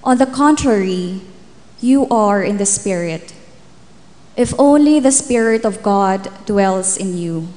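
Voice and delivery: ordinary talk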